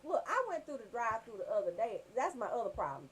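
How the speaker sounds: ordinary talk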